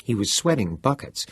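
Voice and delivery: ordinary delivery, nothing marked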